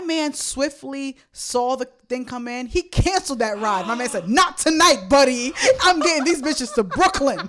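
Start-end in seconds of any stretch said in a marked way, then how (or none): 4.3-7.4 higher and louder, swaggering; louder, higher cocky driver voice; bold driver. Retold or imitated. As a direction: play the driver laying down the law. Voicing the driver's bold reply.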